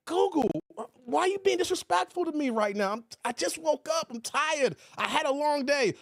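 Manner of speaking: high-pitched retold whine; mock wounded pleading. Just-woken streamer to rude Google, mock hurt complaint. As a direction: plead for sympathy, hammed-up.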